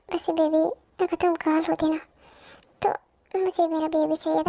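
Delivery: usual speaking voice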